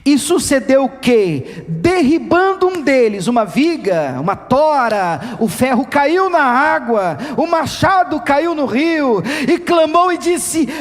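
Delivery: reads the verse slowly, climbing to a cry; dramatic scripture reading. Acted. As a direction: lets the reading swell into the man's wail. Preacher reads the Bible passage.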